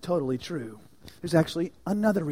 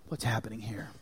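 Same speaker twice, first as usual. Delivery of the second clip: unvoiced, hushed question; whisper; 6 semitones lower, 8 dB quieter than usual. Real, not acted. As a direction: whisper it to draw them in.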